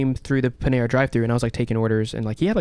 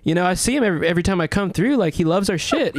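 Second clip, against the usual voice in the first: much higher, easygoing introduction; imitating laid-back skater; 7 semitones higher than usual. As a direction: introduce him like a relaxed pal. Celebrity introduces him, voiced.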